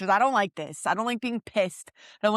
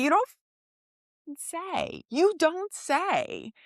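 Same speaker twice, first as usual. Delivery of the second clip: sarcastic; 9 semitones higher, slower than usual. Real, not acted. Sarcastic reaction to a line.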